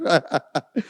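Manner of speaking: a quick amused chuckle; short laugh. Real, not acted. Laughing at himself after mixing up the tribes. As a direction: laugh off the slip. Laughs at his own slip.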